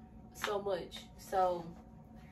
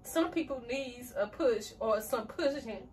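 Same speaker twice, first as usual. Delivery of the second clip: louder, tear-filled journal reading; emotional reading; 3 semitones higher, 6 dB louder than usual. Real, not acted. Reading a journal entry aloud, voice emotional and tearful. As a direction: read on though the tears keep coming. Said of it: "I did not expect to cry".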